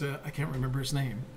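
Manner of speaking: ordinary talk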